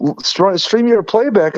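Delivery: ordinary talk, nothing marked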